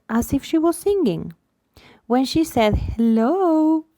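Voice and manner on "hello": high, stretched-out hello. Shown as a demonstration. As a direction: lift hello up high to a baby. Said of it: "her voice went very high again".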